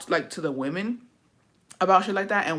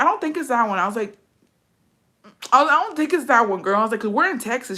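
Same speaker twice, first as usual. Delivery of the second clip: high, louder mock innocence; flustered denial; 6 semitones higher, 3 dB louder than usual. Friend denying it's that bar, high flustered denial. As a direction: backpedal in a high, hammed-up voice.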